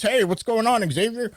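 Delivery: high, chummy phone greeting; overfriendly caller. Retold or imitated. Stranger calls the storyteller like a best friend, overfriendly, high voice. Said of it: "talking to me like he's my best friend".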